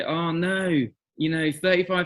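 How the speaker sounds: exaggerated worried lament, pitch climbing high; mock panic. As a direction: overblown hand-wringing. Voices mock parental panic.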